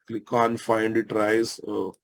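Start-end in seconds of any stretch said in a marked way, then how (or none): none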